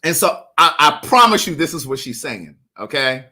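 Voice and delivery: ordinary delivery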